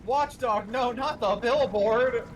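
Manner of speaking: loud, slow, urgent yell at the screen; panicked shout. Real, not acted. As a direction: shout a warning, dragging the words.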